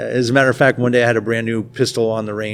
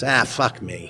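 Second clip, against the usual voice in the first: put-on Boston accent; 3 dB quieter, slower than usual. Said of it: "in my worst or best Boston accent".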